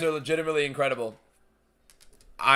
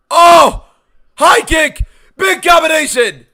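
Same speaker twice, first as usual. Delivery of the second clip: screaming play-by-play, much higher and louder; excited shouting commentary; 14 semitones higher, 14 dB louder, slower than usual. Real, not acted. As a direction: yell every strike as it lands. Live fight play-by-play.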